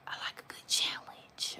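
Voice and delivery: a sly whispered boast. Real, not acted. Nail artist whispering her love of a challenge to viewers. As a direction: whisper it like a little secret.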